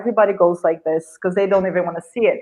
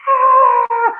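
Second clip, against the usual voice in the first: sharp high kiai shout, much louder and higher; martial arts scream; 5 dB louder than usual. Retold or imitated. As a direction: let out a karate yell as you strike. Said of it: "I scream 'cause you scream".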